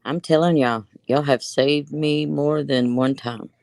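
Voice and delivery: ordinary talk, nothing marked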